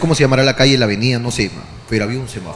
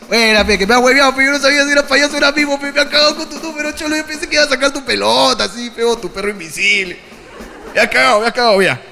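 higher, laughing, admiring banter; amused praise; 7 semitones higher than usual. Retold or imitated. Telling the mime he got fooled, amused. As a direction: tip your hat to the trickster.

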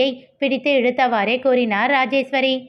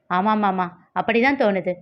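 ordinary delivery

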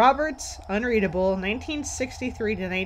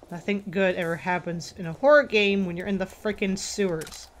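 ordinary talk

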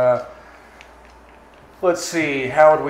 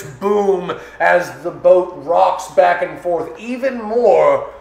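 raised, emphatic action storytelling; game master's dramatic narration; 4 semitones higher, 5 dB louder than usual. Acted. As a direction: stretch the big moments for suspense. Game master narrates the monster's leap.

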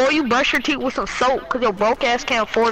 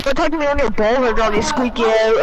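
usual speaking voice

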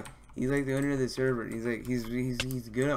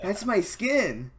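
awed; 8 semitones higher, 6 dB louder, much slower than usual. Real, not acted. Gushing over idol.

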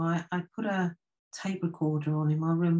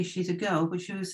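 usual speaking voice

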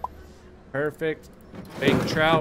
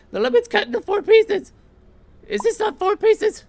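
high, loud exasperated complaint; exasperated protest; 8 dB louder than usual. Real, not acted. Gamer protesting to the game, high and loud. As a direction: protest that it is plainly done.

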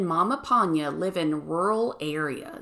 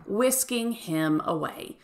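usual speaking voice

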